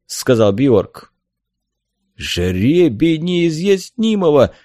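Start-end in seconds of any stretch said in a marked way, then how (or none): 2.2-4.5 raised, slow cry of dismay; horrified exclamation. A narrator's reading. Helmsman fearing for the girl, horrified. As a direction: draw it out, appalled. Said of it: "в ужасе воскликнул кормчий".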